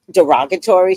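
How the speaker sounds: ordinary talk, nothing marked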